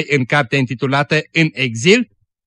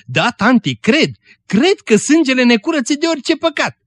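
much higher, ringing declaration of belief; fervent affirmation; 10 semitones higher than usual. A narrator's reading. Dorca confesses her faith to the old woman, fervent affirmation. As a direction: answer eagerly, pitch lifted high.

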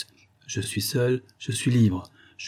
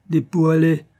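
high, louder sneer; the captain mocking the Dutch girls, louder and higher; scornful jab; 6 semitones higher, 5 dB louder than usual. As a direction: land the insult with contempt.

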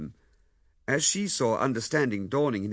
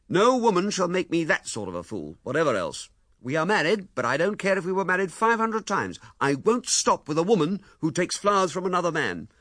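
loud, higher, ranting man's voice; furious tirade; 8 semitones higher, 6 dB louder than usual. A narrator's reading. Husband refusing to stay with his wife, loud tirade. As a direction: let the rage run on.

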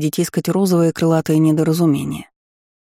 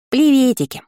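chirpy high greeting; 8 semitones higher than usual. Acted. Gargoyle waves cheerfully.

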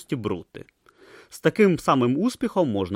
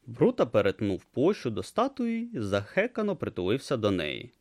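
normal talking voice

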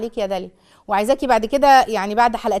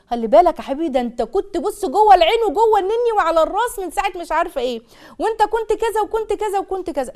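high, fussing praise for the little one; a lightly voiced gushing mother; doting mother; 5 semitones higher than usual. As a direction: coo over the baby like a doting mom.

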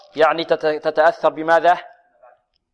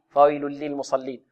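"فويل للمصلين" run through quickly, lams skimmed, softer; snatched, hurried; 2 semitones lower, 5 dB quieter than usual. Shown as a demonstration. Teacher shows the hasty reading, snatched "فويل للمصلين". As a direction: rush "فويل للمصلين" without lengthening. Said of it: "يحذر القارئ من خطفها والإسراع فيها".

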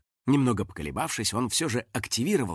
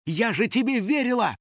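furious; 9 semitones higher than usual. A narrator's reading. Betrayed girl who had trusted him, furious. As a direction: accuse him in a high voice. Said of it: "от ярости закричала Кристина".

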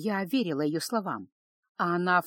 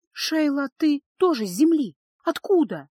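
higher, louder, urgent questions; excited disbelief; 7 semitones higher, 7 dB louder than usual. Acted. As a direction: burst out with amazed hope. Girl discovers fellow Earth native.

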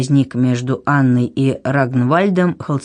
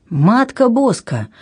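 high, drawn-out cry of despair; slow, raised exclamation of dismay; 7 semitones higher, slower than usual. A narrator's reading. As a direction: wail it in exasperation. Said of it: "в отчаянии воскликнул король".

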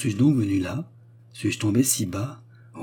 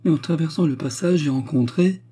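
high-pitched agitated report; distressed; 7 semitones higher than usual. A narrator's reading. Widow confiding a dreaded meeting, distressed. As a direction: build toward a dreadful revelation.

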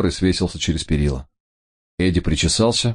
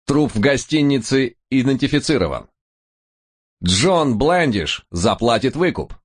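high, measured news-headline voice; headline read; 7 semitones higher than usual. Acted. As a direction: announce it like a newsreader.

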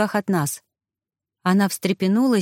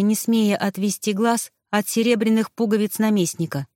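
ordinary delivery, nothing marked

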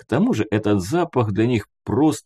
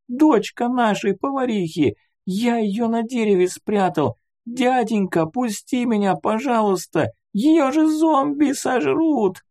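put-on very high, tearful little-boy pleading; bawling child; 13 semitones higher than usual. A narrator's reading. Boy begs to go save the girl, bursting into tears. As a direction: plead desperately as a wailing small boy. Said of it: "Разревелся мальчишка".

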